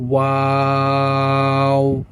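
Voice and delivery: ওয়াও sung long with rounded lips; long rounded sound. Shown as a demonstration. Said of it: "ঠোঁটটি আবার গোল হয়ে যাবে". (ওয়াও ঠোঁট গোল করে লম্বা টেনে)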